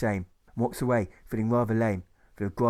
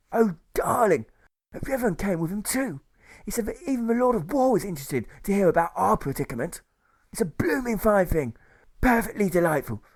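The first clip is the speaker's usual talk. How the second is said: high, chirpy upper-class gushing; gushing nurse; 6 semitones higher than usual. A narrator's reading. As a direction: gush with delight, voice way up. Nurse gushes about the journalist.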